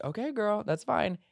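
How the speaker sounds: high, sassy acceptance; friend's resigned okay. Retold or imitated. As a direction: let it go with a shrug. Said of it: "he's like".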